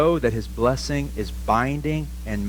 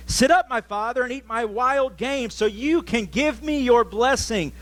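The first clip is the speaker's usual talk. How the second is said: a higher, eager son asking his father; Esau's voice; 9 semitones higher than usual. Acted. Esau urging his blind father to eat, higher voice. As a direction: pitch it up as the hopeful elder son.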